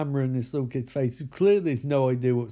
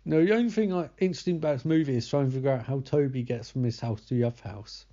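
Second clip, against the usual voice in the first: ordinary talk, nothing marked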